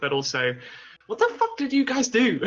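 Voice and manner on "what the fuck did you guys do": high, loud, laughing disbelief; incredulous. Acted. Player to the party after their antics, loudly incredulous.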